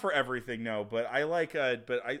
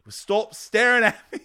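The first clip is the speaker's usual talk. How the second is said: Cockney, higher, louder and slow; a hammed-up rat snapping back; mock Cockney rat; 9 semitones higher, 13 dB louder than usual. Friend voices the rat answering the snake, mock Cockney. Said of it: "the rat had the Cockney accent".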